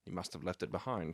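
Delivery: low muttering to himself; muttered. Acted. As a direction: mutter it under your breath. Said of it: "muttered my companion".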